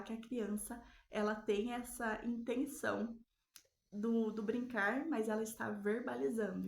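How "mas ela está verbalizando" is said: ordinary talk, nothing marked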